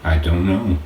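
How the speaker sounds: "I don't know" said flat and even, no lift at the end; just state it, no feeling; truthful and flat. Acted. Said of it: "a truthful and flat".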